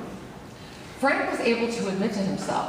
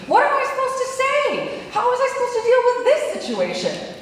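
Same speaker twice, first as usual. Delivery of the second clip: stunned disbelief; 9 semitones higher than usual. Retold or imitated. Shocked by partner's revelation.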